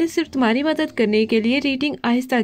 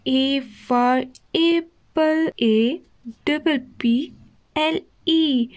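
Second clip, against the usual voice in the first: deliberately slow reading; much slower than usual. Acted. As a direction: drag each letter out very slowly. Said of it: "जानबूझकर slow slow पढ़ रही".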